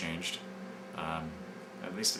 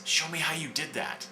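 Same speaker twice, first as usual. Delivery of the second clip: quoted lightly with a higher, eager lift; excited boy; 8 semitones higher than usual. Retold or imitated. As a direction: read it like a kid who just can't wait to learn. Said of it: "He's so excited".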